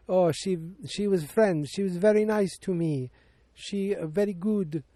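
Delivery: put-on high-pitched, hesitant French-ish accent; vague French accent. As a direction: imitate a foreign girl, rough French accent. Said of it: "a French accent or something".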